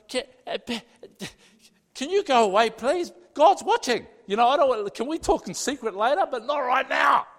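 high, loud panicky pleading; begging him to go away before God sees; flustered plea. Retold or imitated. Flustered plea to Satan, God watching.

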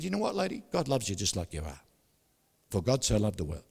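soft, lowering reassurance; gentle reassurance. Retold or imitated. Preacher telling the barber God loves her, gentle reassurance. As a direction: settle down low and tender.

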